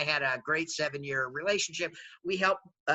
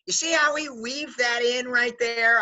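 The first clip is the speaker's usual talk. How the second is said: higher, louder, slow gloating boast; playful gloat; 6 semitones higher, 5 dB louder, slower than usual. Real, not acted. Host gloating to the audience, slow and pleased. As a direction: savor the trick you just pulled. Gloating about his clever question.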